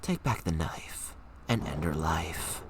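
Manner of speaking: low, slow, menacing whisper; sinister inner voice. Acted. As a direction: hiss it like the evil voice in your head. Reads the dark inner voice.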